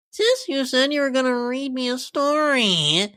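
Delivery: a small filly whining at her big sister; little sister voice. Acted. Little sister whining at Rarity to read the bedtime story.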